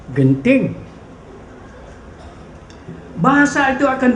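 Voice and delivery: impassioned poetry reading, swelling and falling; poetry recitation. Acted. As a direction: declaim the poem in measured phrases with dramatic pauses. Recites a poem aloud. (deklamasi puisi yang dramatik)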